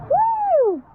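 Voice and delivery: loud, high whoop of thrill; excited whoop. Real, not acted. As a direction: cheer on the way down. Riding down the water slide.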